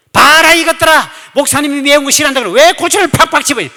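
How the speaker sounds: high, very loud outburst; angry scolding shout. Retold or imitated. Church deaconess berating kimchi helpers over chili, angry shout. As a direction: shout it like a furious quarrel.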